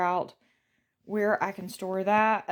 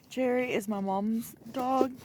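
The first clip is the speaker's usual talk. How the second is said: normal talking voice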